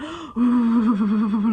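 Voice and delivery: loud, shuddering panicky breaths; mock hyperventilation, long and loud; imitated panicked breathing. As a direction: act out hyperventilating. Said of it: "I was just really panicked".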